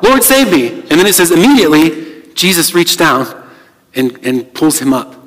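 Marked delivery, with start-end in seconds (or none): 0.0-0.8 pitched-up plea for rescue; crying out. Acted. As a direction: call out high like a sinking man. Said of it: "immediately he cries out".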